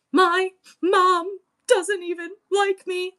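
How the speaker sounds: high, slow imitated child voice; hurt little boy. Six-year-old after being yelled at, hurt child voice.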